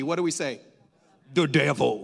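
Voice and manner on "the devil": put-on Southern preacher accent. Preacher prompting the church to name the enemy, Southern preacher accent. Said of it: "say it with that accent".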